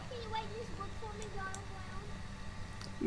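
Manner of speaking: put-on high, soft baby voice. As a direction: coo it like a small child. Teasing voice to ghost.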